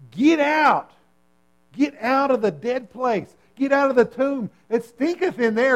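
Jesus calling Lazarus out of the tomb, loud and high. Acted.

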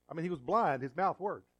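ordinary talk

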